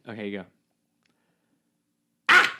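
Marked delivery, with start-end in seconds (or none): 2.3-2.5 sudden sharp sneeze; imitated loud sneeze. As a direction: burst out with a fake sneeze. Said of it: "and he'd go".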